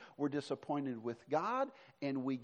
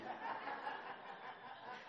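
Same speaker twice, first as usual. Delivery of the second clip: laugh; 7 semitones higher, 14 dB quieter than usual. Real, not acted. Laughs at his own joke.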